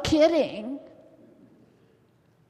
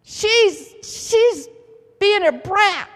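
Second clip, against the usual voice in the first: upset Sarah; 5 semitones higher, 4 dB louder, slower than usual. Retold or imitated. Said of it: "she's saying".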